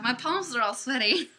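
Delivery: normal talking voice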